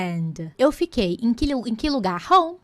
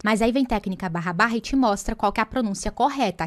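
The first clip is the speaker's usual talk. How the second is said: ordinary talk, nothing marked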